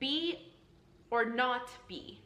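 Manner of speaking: "Be" high and alone, then "not be" punched; stressed words singled out. Shown as a demonstration. Teacher picking out Hamlet's question, high stress on "Be" and "not be". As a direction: hit "Be", pause, then hit "not" and "be". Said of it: "That's what we're stressing".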